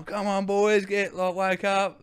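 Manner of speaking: imitating Bert's German accent, high and loud; a cheery German brewer rousing hungover boys. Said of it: "his German accent".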